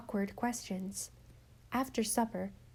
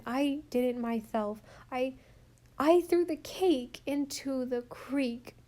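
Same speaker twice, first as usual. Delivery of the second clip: put-on distressed little girl; 3 semitones higher, slower than usual. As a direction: blurt out the confession like a frightened child. Rilla confesses to Susan.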